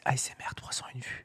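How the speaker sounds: soft unvoiced ASMR whisper. Shown as a demonstration. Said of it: "Par exemple, on pourrait faire". (chuchotement ASMR)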